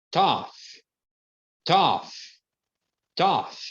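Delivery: "tough" ends in an F sound, "taf". Shown as a demonstration. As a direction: repeat "tough" with a clear final F. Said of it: "quando ele tem som de F". ("tough" com som de F no final)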